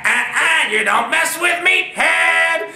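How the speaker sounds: slow, high drawn-out yell; slow high battle cry; catchphrase shout. Retold or imitated. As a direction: bellow the catchphrase. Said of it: "I said".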